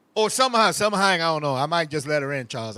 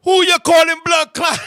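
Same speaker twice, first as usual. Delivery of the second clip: put-on Jamaican accent, higher and louder, offended; angry Jamaican accent; 5 semitones higher, 7 dB louder than usual. Jamaican man yelling at the covid shot lady. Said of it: "Jamaican guy comes".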